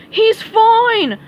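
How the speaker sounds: very high, loud protest; cried out. Acted. Patrick chasing Sandy, loud high cry. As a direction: cry it out while running. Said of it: "cried Patrick".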